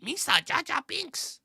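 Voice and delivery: high, drawn-out cartoon alien impression; mock Jar Jar voice. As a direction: ham up a goofy alien character name. Playful character voice on name.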